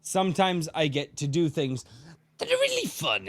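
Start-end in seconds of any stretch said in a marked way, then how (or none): 2.4-3.3 much higher, manic villain cackle-voice; Joker voice. Shown as a demonstration. Podcaster showing off his Joker voice, much higher. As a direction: spit it out like a grinning madman. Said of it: "sound like the Joker".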